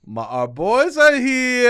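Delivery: high, loud, slow sing-song welcome; lunch ladies greeting. Retold or imitated. Lunch ladies welcoming the boys, high drawn-out sing-song. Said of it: "They go".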